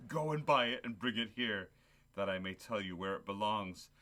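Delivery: ordinary talk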